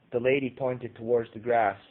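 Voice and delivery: ordinary delivery, nothing marked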